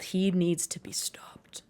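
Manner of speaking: slow, hushed menacing whisper; slow conspiratorial whisper. Pretend. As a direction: breathe it like a dark secret threat. Joke menace about Santa.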